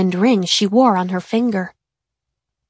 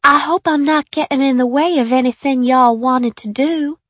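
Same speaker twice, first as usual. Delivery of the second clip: put-on higher shy Southern girl voice; thick Southern accent; 5 semitones higher than usual. A narrator's reading. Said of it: "she said in her thick Southern accent".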